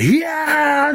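Miyagi's high kiai shout; karate shout. Retold or imitated. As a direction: yell it like a karate master blocking kicks. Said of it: "and then just went".

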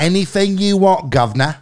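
loud, high-pitched Cockney demo; mock Cockney. Shown as a demonstration. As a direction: put on a hammy Cockney British accent. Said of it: "that's my Cockney sort of British".

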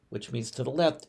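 normal talking voice

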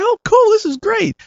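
put-on very high, delighted child voice; a boy's squeal of joy; thrilled kid. Kid hearing Steve will fix his bike, very high and thrilled. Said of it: "I was like".

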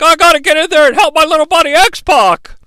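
high-pitched imitated Mick; mock eager wrestler. Mick wanting to help his buddy, high pleading imitation. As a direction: pipe up high in mock urgency. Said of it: "Mick's like".

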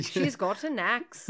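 slow Irish lilt rising like a question; Irish accent attempt. Shown as a demonstration. Friend showing the trick to the accent, questioning Irish accent. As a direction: demonstrate mock Irish with questioning tune. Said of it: "say everything like it was a question".

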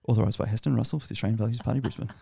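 rattled off fast like a disclaimer; really fast deep voice. Shown as a demonstration. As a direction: rush through it quickly. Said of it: "say it really fast in a deep voice".

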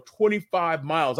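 usual speaking voice

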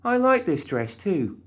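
high, slow sulky whine; slow, high pouty complaint; pouting. Acted. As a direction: sulk over the ruined dress. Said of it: "she pouted".

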